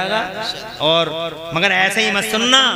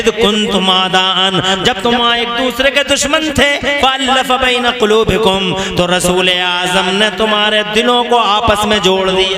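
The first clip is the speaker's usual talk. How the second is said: intoned preaching; 5 dB louder than usual. Real, not acted. Preacher urging believers not to divide, intoned chanting delivery. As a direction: intone verse and meaning on a sustained note.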